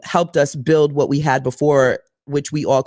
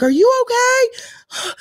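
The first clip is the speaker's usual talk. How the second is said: worried check; slower than usual. Retold or imitated. Checking on the stranded driver.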